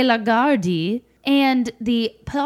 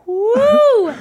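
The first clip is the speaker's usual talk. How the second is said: high, loud, drawn-out "woo"; exclaimed whoop; 11 semitones higher, 7 dB louder than usual. Real, not acted. Exclaiming over old student videos, loud whoop. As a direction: let out a big whoop.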